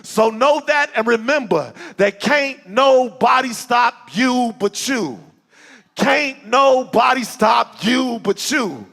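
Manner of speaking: rising, rhythmic poem recitation; spoken word poem. Acted. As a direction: build the poem to a rousing peak. Recites his poem Dream Big.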